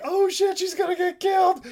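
very high panicked cry; shocked crowd. Retold or imitated. Crowd watching the bull charge, high panicked cry. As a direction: squeal it in horror.

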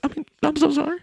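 high drive-through worker; high put-on voice. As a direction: squeak it out quickly.

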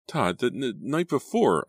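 high, halting stammer. A narrator's reading. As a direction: trip over the words nervously. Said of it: "I stammered".

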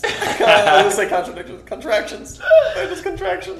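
giggling through the words; laughing. Real, not acted. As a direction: laugh while correcting yourself. Laughing at own flub.

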